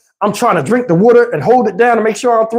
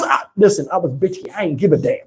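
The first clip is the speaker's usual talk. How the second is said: ordinary talk, nothing marked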